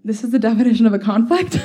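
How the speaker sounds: quick, laughing disbelief; laughing quip. Retold or imitated. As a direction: rattle it off through laughter. Joking about the dilemma.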